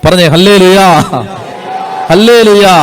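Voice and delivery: a preacher's loud, fervent cry of praise; loud hallelujah call. Real, not acted. Preacher calls congregation to respond.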